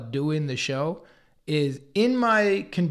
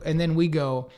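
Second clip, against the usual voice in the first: ordinary delivery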